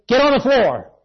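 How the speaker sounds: loud, clear order; firm command. Retold or imitated. Father ordering his family down in a mall shooting, loud and firm. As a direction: bark it so the whole family drops. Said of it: "The father spoke firmly and clearly".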